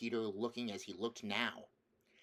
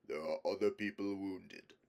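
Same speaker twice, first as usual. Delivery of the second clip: lion voice; 2 semitones lower than usual. Acted. Aslan speaks.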